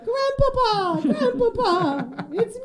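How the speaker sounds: high, put-on voice, the middle Grandpapa stretched slow; mock tearful robot reunion. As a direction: call out to grandpa like a long-lost grandchild. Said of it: "He's like".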